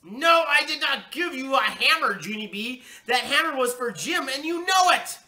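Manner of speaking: high, loud outraged yelling; furious mother. A narrator's reading. Steaming mother scolds daughter over hammer, yelling furiously. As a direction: explode with anger, building to the end. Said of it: "steam came out of Mother's head".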